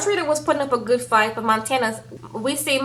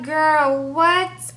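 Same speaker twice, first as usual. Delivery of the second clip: incredulous reaction; 5 semitones higher than usual. Retold or imitated. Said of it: "And I'm like".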